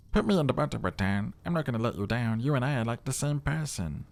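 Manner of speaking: wheedling necktie begging to go in the butter; pleading talking necktie. Acted. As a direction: press your case like a needy sidekick tie. Necktie voice held over lines.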